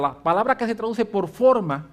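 ordinary talk, nothing marked

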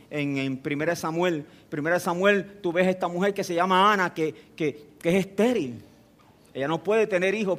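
ordinary talk, nothing marked

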